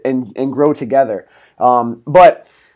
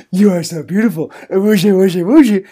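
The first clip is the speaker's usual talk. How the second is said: put-on higher, sing-song puppy baby talk; baby talk to dog; 5 semitones higher than usual. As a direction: gush over the dog in baby voice. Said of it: "speaking like that to her".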